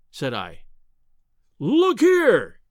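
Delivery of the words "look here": high, loud exclamation; cry out. A narrator's reading. Professor urging the narrator to see the picture, loud and high. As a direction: burst out to grab his attention. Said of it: "he cried".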